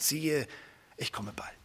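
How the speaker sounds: soft, reverent quiet quote; hushed quote. Acted. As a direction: let the promise fall to a near whisper. Quoting Jesus softly.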